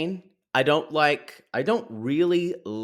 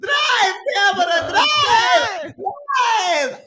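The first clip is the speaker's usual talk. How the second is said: shouting; 5 dB louder, much slower than usual. Retold or imitated. Retold screaming at TV.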